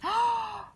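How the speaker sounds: high sharp gasp of alarm; mock gasp. A pretend gasp at the runaway pram. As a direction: gasp as if startled.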